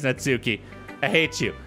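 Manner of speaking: ordinary talk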